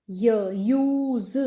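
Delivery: य held, then यूज, both very slow; sounding out use. Shown as a demonstration. Teacher isolating the य sound for the class. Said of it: "य sound आ रही है".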